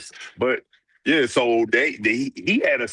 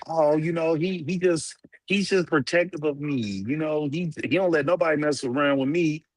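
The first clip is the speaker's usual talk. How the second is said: higher-pitched mock girlfriend voice; imitating smitten women; 7 semitones higher than usual. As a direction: play a woman excusing her boyfriend, pitched up. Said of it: "females think it's cute".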